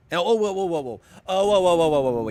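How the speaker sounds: loud, high startled whoa whoa; repeated whoa of alarm. Real, not acted. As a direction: react to a sudden threat. Startled in game.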